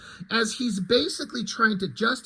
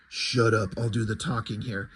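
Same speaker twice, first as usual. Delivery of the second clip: a much lower, growled tough-guy voice; low gruff Batman; 9 semitones lower, 4 dB quieter than usual. Retold or imitated. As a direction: growl it low like a hard-boiled vigilante.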